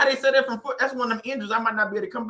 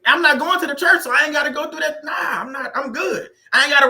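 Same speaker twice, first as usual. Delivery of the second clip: 1 emphatic refusal; 7 semitones higher, 5 dB louder than usual. Real, not acted. Refusing sister's church invite loudly.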